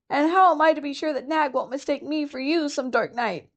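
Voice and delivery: a nervous little rat fearing the snake; fretful muskrat. Acted. Chuchundra frets to Rikki.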